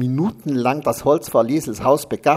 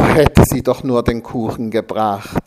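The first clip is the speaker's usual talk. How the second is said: usual speaking voice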